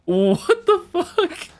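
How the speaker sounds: high, slow, baffled what the fuck; incredulous. Real, not acted. Baffled by his new role.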